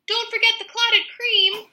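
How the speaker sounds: high, loud call from under the truck; shouted. A narrator's reading. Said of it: "shouted the woman".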